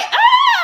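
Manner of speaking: very high, loud mock scream; shocked yelp. Storyteller acting out the moment of being cuffed, shocked yelp. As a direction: yelp as if suddenly grabbed.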